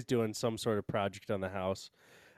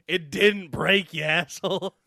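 mock angry retort; 6 semitones higher, 6 dB louder than usual. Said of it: "calling Lowe's and going".

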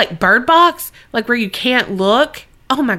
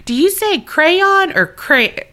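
ordinary talk

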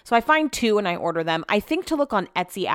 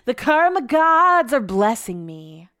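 slow, drawn-out, higher mock-grand line; playful proclamation; 4 semitones higher, much slower than usual. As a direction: proclaim it playfully, stretching the words. Mock celebration of good karma.

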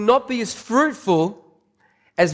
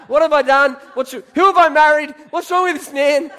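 higher, louder mock panic; mock freak-out; 7 semitones higher, 3 dB louder than usual. Wife freaking out on the honeymoon, higher mock panic. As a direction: play the new bride panicking, pitched up. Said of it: "So she freaks out".

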